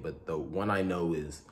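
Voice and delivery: normal talking voice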